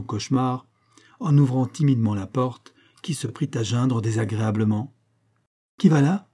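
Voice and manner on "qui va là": a startled shout into the dark, louder; the frightened wife cries out at the creaking door, startled; startled cry. A narrator's reading. Scene: the wife woken at night, crying out startled. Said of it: "s'écria madame Cœur".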